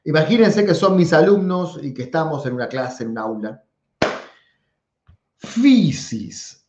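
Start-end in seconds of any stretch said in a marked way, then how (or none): 5.4-6.6 fisis said slowly, pitched up with the stress high; fisis, Greek style, high first syllable; Greek pronunciation. Shown as a demonstration. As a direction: fisis with Greek accent raised a fifth. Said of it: "quiero que en su casa traten de pronunciar".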